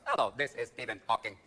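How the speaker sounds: even, computerised monotone like a speech synthesizer; soft robotic monotone; Stephen Hawking voice. Retold or imitated. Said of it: "called Stephen Hawking's house".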